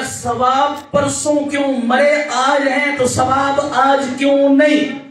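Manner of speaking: slow, loud, drawn-out question; insistent demand. Real, not acted. Preacher loudly challenging the young man. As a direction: press the crowd hard for an answer.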